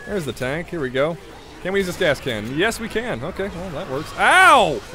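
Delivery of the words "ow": sudden loud high yelp; startled yelp. Real, not acted. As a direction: yelp in surprise. Hit by zombie in game.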